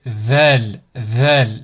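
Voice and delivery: Zal with the tongue tip out between the front teeth; Zal, voiced "th" pushed out past the incisors. Shown as a demonstration. Teacher demonstrating the Arabic letter Zal, tongue between the teeth. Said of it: "la langue doit sortir au niveau des incisives".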